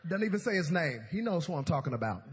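ordinary talk

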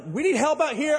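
high, urgent mock panic call; panicked radio call. Stranded captain radios the marina for help, panicky. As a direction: plead over the radio, alarmed. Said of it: "I was panicking".

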